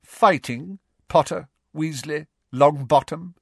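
cold sneer. A narrator's reading. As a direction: sneer coldly, pausing between each name. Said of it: "Snape said in his cold, sneering voice".

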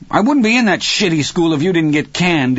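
high-pitched blurted retort; angry outburst. A narrator's reading. Dennis bursts out at his father, angry outburst. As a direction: burst out, voice shooting up. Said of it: "he burst out".

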